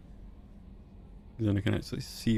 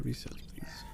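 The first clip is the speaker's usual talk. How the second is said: whispering; 9 dB quieter, slower than usual. Real, not acted. Quiet aside off-mic.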